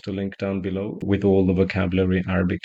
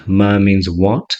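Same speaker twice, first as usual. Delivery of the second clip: ordinary talk, nothing marked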